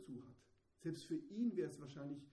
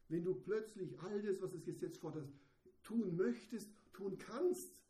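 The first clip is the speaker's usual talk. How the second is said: loud, high, urgent preaching; fervent sermon climax; impassioned preaching; 3 semitones higher, 6 dB louder than usual. Real, not acted. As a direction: drive the point home with fervor. Preaching at full force.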